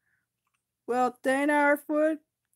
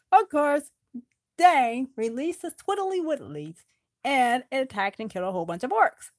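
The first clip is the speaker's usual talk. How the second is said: ordinary delivery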